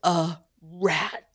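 imitating careful diction, "a rat" in separate words; mock-precise "a rat", every consonant placed; over-enunciated. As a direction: sound out "a rat" distinctly with a crisp final t. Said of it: "you're enunciating".

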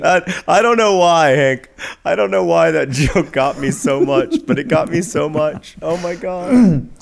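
loud laughing through the words; cracking up helplessly mid-sentence; real laughter. Real, not acted. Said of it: "I'm literally in tears".

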